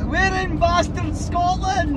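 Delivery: high, slow triumphant cry; gleeful drawn-out arrival cheer; jubilant exclamation. Real, not acted. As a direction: crow it out, pitched right up. Celebrates arriving in Scotland.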